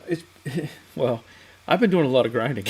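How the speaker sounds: talking through a laugh. Real, not acted. Host tells viewers about endless grinding, laughing through it. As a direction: chuckle while admitting the work.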